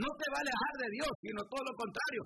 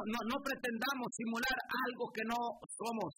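normal talking voice

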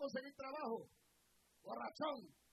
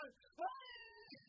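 mock whimper. Mimicking someone still moaning.

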